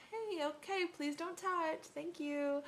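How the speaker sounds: high, restrained "please don't" to a stranger; retold sugary-polite objection; politely firm request.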